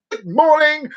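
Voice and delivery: high, chirpy morning hello; mock hyper cheeriness; over-cheerful greeting. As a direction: greet them relentlessly brightly. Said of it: "going up to people in the morning".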